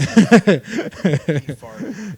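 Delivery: loud laughing exclamation. Real, not acted. Host cracking up at the fart story. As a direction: blurt it out laughing.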